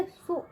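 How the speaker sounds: सु as one short, soft syllable; pronunciation demo. Shown as a demonstration. Teacher answers how the matra sounds, सु short and soft. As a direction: say सु briefly with a short u. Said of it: "उसका उच्चार कैसे करेंगे?".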